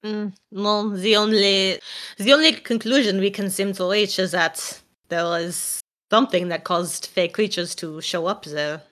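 put-on polite inquiry in a French accent; French-accented character. Asking about talk with Monsieur Jacques, in a French accent. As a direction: ask evenly, with a French accent.

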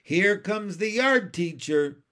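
high, loud schoolchild alert; warning call. A narrator's reading. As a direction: call out that trouble is coming. Child warns crowd of approaching teacher.

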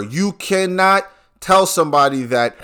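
ordinary talk, nothing marked

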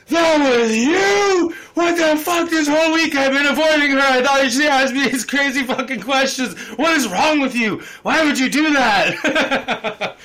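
high, loud, disbelieving yell with a laugh; coworker's shocked outburst. Retold or imitated. Coworker finds out the prank caller was his friend, high shocked outburst. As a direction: shriek it like you just got pranked. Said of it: "And he was like".

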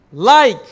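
like punched loud and held; like hit loud before dropping to God; stress on like. Shown as a demonstration. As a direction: lean hard on the word like. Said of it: "The reason he says "like".

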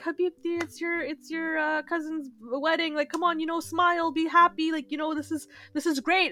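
lightly voiced cheerful dad. Dad grabbing his panicking daughter, lightly coaxing. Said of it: "he's like".

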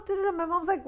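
ordinary talk

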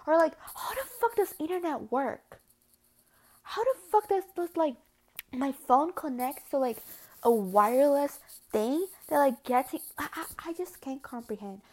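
loud, high, baffled ranting; exasperated rant. Real, not acted. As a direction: throw up your hands in disbelief. Baffled rant about technology.

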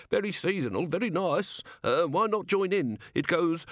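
high, pleading little gnome; desperate gnome. A narrator's reading. Gnome begs Ridcully to hear its bells, high and desperate. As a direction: make a last high-pitched offer. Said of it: "said the gnome desperately".

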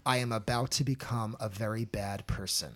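dramatic opening line. Shown as a demonstration. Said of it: "give it to us".

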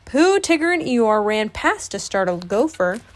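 usual speaking voice

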